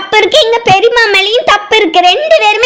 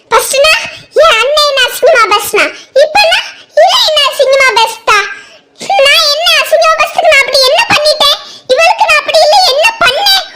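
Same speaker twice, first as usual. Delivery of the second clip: tearful outburst; 5 semitones higher than usual. Acted. Woman sobbing out her grievance.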